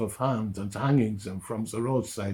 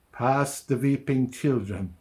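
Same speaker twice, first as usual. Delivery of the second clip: ordinary delivery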